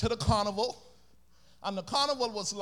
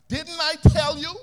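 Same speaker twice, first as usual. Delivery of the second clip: loud, high reproach; scolding mother; 6 semitones higher, 9 dB louder than usual. Acted.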